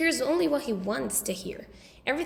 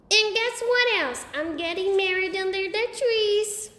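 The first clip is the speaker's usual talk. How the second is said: high, loud eager announcement; excited kid; 8 semitones higher, 4 dB louder than usual. A narrator's reading. Fudge bragging to Buzzy Senior, excited and high. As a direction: burst out with the big news.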